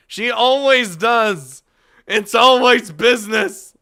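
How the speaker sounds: mock exasperation. Mocking Shizune's seriousness.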